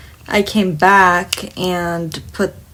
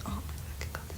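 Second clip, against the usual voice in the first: barely audible whispered aside; whispered exasperation; 21 dB quieter, faster than usual. Retold or imitated. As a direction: whisper it under your breath in disbelief. Muttered reaction to rude customer.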